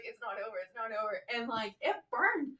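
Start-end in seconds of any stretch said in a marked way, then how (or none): 0.0-1.2 much softer, hushed ominous repeat. Retold or imitated. Nurse twirling the swab in her nose, hushed ominous voice. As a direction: murmur it like a creepy threat.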